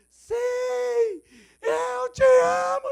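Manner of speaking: high, slow, crying cry of love; tearful shout. Retold or imitated. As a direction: break down as you shout it.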